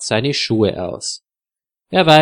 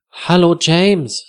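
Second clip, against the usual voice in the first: ordinary delivery, nothing marked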